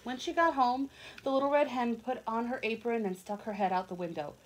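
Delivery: put-on lively read-aloud for young children; storybook reading. A narrator's reading. Reading a picture book aloud to kids, lively storytelling. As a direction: tell it like a bedtime picture book.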